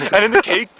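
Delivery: ordinary talk, nothing marked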